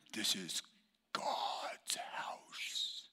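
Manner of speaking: slow, drawn-out whisper; pious hushing whisper; hushed reverence. Acted. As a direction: whisper it solemnly, word by word. Acting a churchgoer hushing others.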